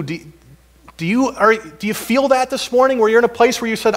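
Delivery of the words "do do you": normal talking voice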